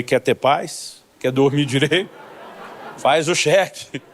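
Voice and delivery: devagar, cada pergunta bem espaçada; dê o conselho com calma marcada; conselho pausado. Retold or imitated. Pastor advises brother, deliberate. (pastor aconselhando o irmão, bem devagar)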